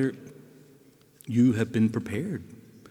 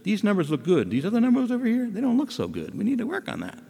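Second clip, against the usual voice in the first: higher, frank doctor giving test results; doctor delivering news; 10 semitones higher than usual. Retold or imitated. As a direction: play the straight-talking doctor. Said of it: "And the doctor says".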